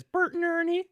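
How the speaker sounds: put-on very high, tiny child voice; Haley Joel Osment. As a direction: squeak it out like a little boy. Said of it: "Haley Joel Osment says".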